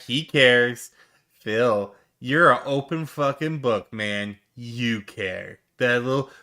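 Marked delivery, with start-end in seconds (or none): none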